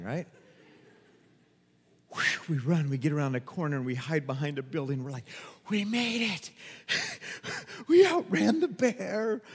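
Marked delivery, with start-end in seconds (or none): 5.7-9.4 mock winded relief; acted out of breath. Kids hiding behind a building after the bear, acted breathless relief. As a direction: gasp it out as if just done running.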